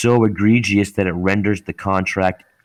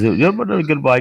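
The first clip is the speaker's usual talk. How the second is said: dismissive rep; 7 semitones higher than usual. Retold or imitated. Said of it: "he goes".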